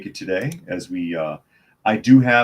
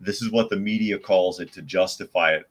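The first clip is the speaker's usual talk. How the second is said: ordinary talk, nothing marked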